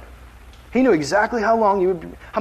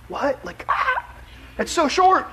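mock shock; 10 semitones higher, slower than usual. Shocked at forty-second life.

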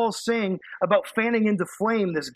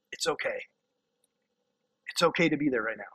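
reassuring; 5 semitones lower, 5 dB quieter, faster than usual. Real, not acted. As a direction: lower and softer, gentle comfort. Comforting discouraged listeners.

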